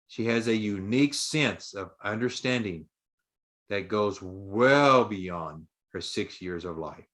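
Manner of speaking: ordinary delivery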